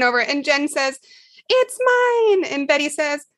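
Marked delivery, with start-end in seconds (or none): 1.5-2.5 put-on child's whine. Kids fighting over toy, voiced.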